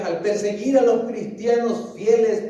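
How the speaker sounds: ordinary delivery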